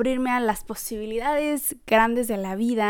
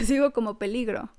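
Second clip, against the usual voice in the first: normal talking voice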